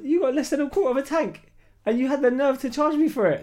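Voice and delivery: indignant rant at the dealer; street attitude. Retold or imitated.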